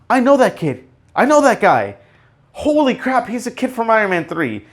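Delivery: high-pitched, thrilled exclamations; excited recognition. Retold or imitated. Fan recognising the kid from Iron Man three, excited high voice. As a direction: re-enact an excited fan spotting a familiar face.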